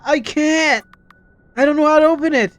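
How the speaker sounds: high, loud frantic shouting; yelling in panic at a locked door; panicked yelling. Real, not acted.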